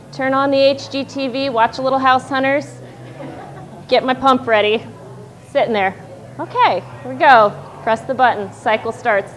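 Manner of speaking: ordinary delivery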